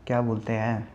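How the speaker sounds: dropped low and soft, searching for the word; hushed aside. Acted. Roaster lowering his voice to find the insult, hushed. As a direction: mutter it like a half-secret slur.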